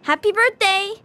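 high, excited birthday cheer; spring the greeting on your friend. Acted. Friend to surprised birthday kid, excited high greeting.

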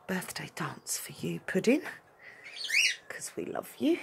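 whispered dedication; soft unvoiced tender words. Real, not acted. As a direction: whisper it lovingly to the dog. Dedicating dance.

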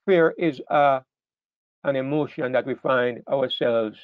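ordinary talk

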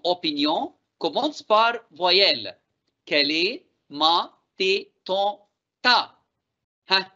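normal talking voice

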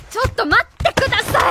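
loud, high call after him; sharp call to stop. Acted. Teacher yanks the man back, calling loudly. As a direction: stop him before he leaves.

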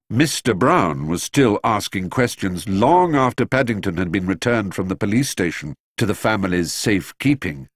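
plain reading voice

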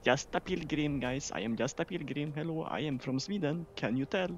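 lightly hammed-up Swedish accent; a playful hint of a Swedish accent; mock Swedish accent. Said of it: "Just because of her fucking accent".

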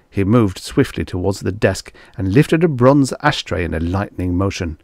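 plain reading voice

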